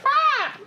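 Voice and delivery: put-on very high squeaky echo of a baby's cry; toy echo squeal. Cactus toy copying the baby, very high squeal. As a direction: mimic the cactus toy parroting back. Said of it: "And it'll be like".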